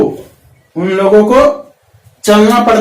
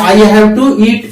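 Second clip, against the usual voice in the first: slow, careful English model line; "I have to speak" drawn out word by word for learners; slow drill; much slower than usual. Shown as a demonstration. Teacher models English for Hindi-speaking students, slow and careful. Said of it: "first form में आपको बोलना है".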